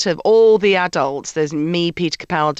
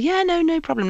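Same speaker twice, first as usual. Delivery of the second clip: higher, easygoing and obliging; breezy yes-to-everything tone; mock accommodating pushover; old obliging self; 11 semitones higher than usual. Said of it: "And I used to be".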